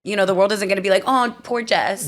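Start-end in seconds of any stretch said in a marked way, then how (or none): 1.1-2.1 slow, drawn-out fake sympathy; mock pity. Imitating the world feeling sorry for her, mock pity. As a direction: draw it out as if pitying someone, mockingly.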